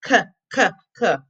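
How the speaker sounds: "K" as a short kuh, not kay; K sound. Shown as a demonstration. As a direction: separate kuh bursts for each "K". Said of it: "begin with the letter K".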